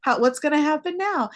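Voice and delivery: reader's confused double take; puzzled surprise. Retold or imitated. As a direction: realize the ending isn't here yet. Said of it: "I'm like".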